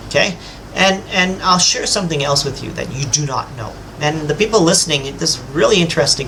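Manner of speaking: usual speaking voice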